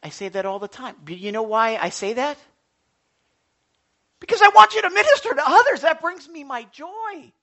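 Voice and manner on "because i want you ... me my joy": much higher, louder, fast; impassioned urging. Real, not acted. Pastor urging believers to serve others, loud and fast. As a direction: burst out with urgent passion.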